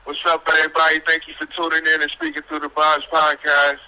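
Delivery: thin phone-line voice; talking over a prison phone; payphone call. Real, not acted. Prison collect call podcast intro.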